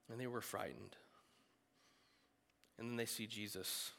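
hushed whisper. A narrator's reading. Preacher telling the frightened disciples, whispered. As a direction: drop to a whisper for the fear.

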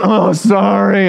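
put-on, overdone regret; fake apology. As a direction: stretch out an insincere kid's apology. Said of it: "That's so fake".

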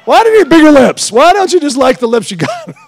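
loud, high incredulous questioning; baffled asking why anyone needs bigger lips. Retold or imitated. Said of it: "I'm figuring".

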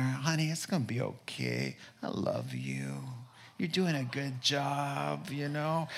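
tender comforting. Retold or imitated. Husband hugging his crying wife, soft and tender. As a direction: imitate a gentle hug in the voice, hushed.

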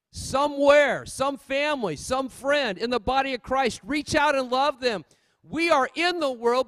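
normal talking voice

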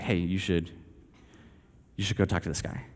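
soft, low nudge with a long hesitation; quiet inner prompting. Retold or imitated. Spirit nudges him to talk to a guy, quiet prompting.

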